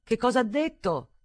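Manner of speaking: loud, high call; shouting over wind. A narrator's reading. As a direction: shout the question across the wind. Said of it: "gridò".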